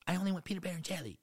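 put-on high, fast whiny toddler; little girl voice. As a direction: whine like a picky toddler.

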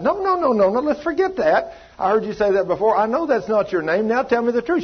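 high, urgent repeated no, no; insistent no. Retold or imitated. Preacher retells pressing a woman for her name, high insistent.

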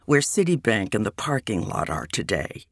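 ordinary delivery, nothing marked

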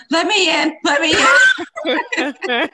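high, loud frantic begging; panicked pleading. Retold or imitated. Friend retelling the doomed driver, high panicked yelling. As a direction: mock-desperate cry to be let in. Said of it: "He was like".

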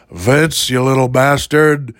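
terrible Stone Cold. Acted. Fan mimics wrestler cussing out Vince, hammed-up. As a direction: cuss like a badly imitated wrestler. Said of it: "That's my terrible Stone Cold".

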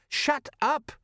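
very high-pitched, panicky acted protest; frantic. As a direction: silence them in a strained, squeaky-high voice. Nervous sergeant's repeated plea, now frantic.

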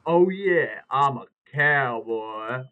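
loud, slow show-off boast; hammed-up bull rider bragging, drawn out; cocky cowboy brag. Friend showing off to the girls from the bull, loud and slow.

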